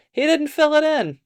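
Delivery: high-pitched dog character; old dog. A narrator's reading. Old dog complaining to a companion, high voice. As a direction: point it out in a high voice. Old dog's line in story.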